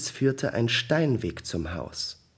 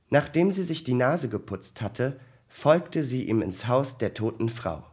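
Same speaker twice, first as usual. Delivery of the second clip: ordinary delivery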